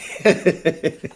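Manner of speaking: real short, voiceless chuckle. Real, not acted. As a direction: laugh lightly after announcing you're back. Amused at announcing his return.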